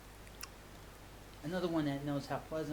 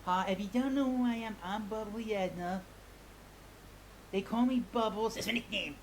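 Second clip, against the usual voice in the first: Bubbly Edna; 5 semitones higher than usual. Acted. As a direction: pop up bright as a cheerful hostess persona. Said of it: "I gonna bring back Bubbly Edna".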